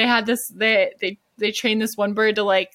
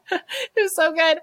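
teary; 8 semitones higher than usual. Real, not acted. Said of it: "I was crying watching it".

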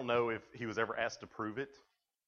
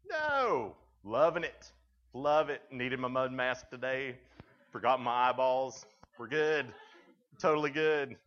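sarcastic glee; 2 semitones higher, 7 dB louder, slower than usual. Pretend. Mock cheerful reaction to mud.

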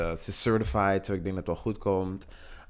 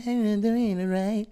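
chirpy, slow and higher; high sing-song voice; 11 semitones higher, slower than usual. Retold or imitated. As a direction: cheer it like a dancing diva. Imitating dancing performer.

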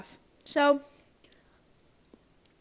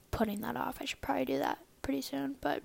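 whispering; 2 semitones higher, 8 dB quieter, faster than usual. Real, not acted. Guilty aside about chores.

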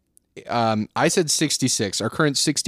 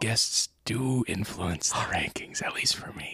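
mock-secret whisper; 8 dB quieter than usual. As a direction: let them in on a secret, barely audible. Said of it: "I'm gonna say it in a whisper".

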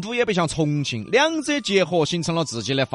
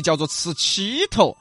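normal talking voice

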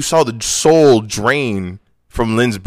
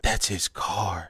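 stunned realization; 6 semitones lower, 13 dB quieter, much slower than usual. Retold or imitated. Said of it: "it dawns on me".

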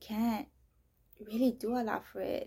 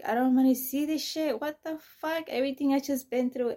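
louder, higher exasperated cry; frustrated outburst; 5 semitones higher, 9 dB louder than usual. Retold or imitated. Woken by demon nightmares, loud exasperated outburst. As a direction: push back hard against the nightmares.

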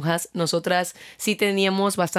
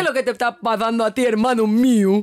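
put-on Esmeraldas accent. Co-host does the coworker's coastal accent, fast. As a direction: rattle it off like a coastal man. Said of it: "Y decía".

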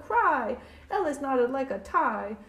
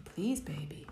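whisper; 2 semitones lower, 7 dB quieter than usual. Acted. Refrain whispered in story.

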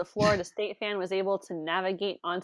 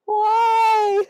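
laughing cry; 11 semitones higher, 7 dB louder, much slower than usual. Real, not acted. Helpless laughter at absurd news.

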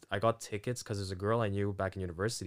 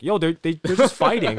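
laughing alarm; 7 semitones higher, 11 dB louder than usual. Retold or imitated. Panicking in middle of fight.

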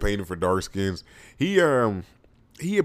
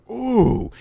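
moved ooh; 14 semitones higher, 6 dB louder than usual. Retold or imitated. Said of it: "go back to that song and be like".

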